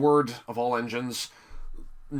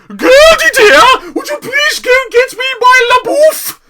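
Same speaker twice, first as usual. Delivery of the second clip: shrieking mock request; 15 dB louder than usual. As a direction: screech the request like a pampered diva. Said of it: "It's like".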